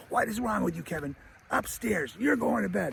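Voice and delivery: high, softened mock scolding; exasperated mom. Mom sends Kevin upstairs, high mock scolding. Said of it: "Kevin's mom is like".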